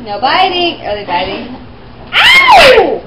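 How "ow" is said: sudden high yelp of pain. Real, not acted. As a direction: yelp as if bitten. Bitten by a dog.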